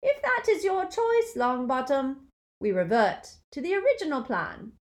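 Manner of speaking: high silky menace. A narrator's reading. Dark lord answering Neville, silky menace. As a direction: silk over danger. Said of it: "the silkiness of his voice".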